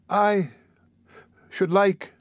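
put-on high, halting little child's voice; faltering. Dick timidly asks a favour of the beadle, faltering. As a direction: break off timidly after the first word. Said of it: "faltered the child".

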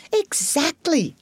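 high, drawn-out confirmation; stretched, emphatic agreement. Acted. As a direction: pounce on the point with slow relish. Badger seizes on the point.

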